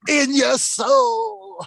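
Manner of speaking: slow, drawn-out high shout; mock shout. Teasing about the Zumba, slow shouted soul. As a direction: belt it like a hype announcer.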